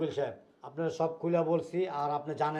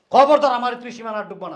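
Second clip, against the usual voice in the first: loud, raised-pitch threat; angry warning; 6 semitones higher, 10 dB louder than usual. Acted. Elder throwing the son-in-law out, furious. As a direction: bellow a warning to stay out. Orders intruder out of house.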